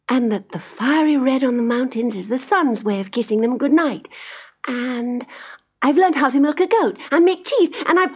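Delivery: put-on high, eager young girl; bright chatter of a mountain child; little girl voice.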